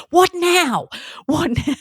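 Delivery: high-pitched, fed-up demand; hammed-up parent at the end of patience; exasperated. Mum called back in every time, exasperated. Acted exasperated return to child's room.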